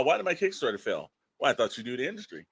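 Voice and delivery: ordinary talk, nothing marked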